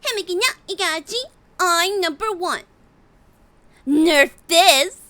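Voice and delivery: put-on high bright gamer girl; cute anime girl lines. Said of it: "Could you do any Overwatch voices?".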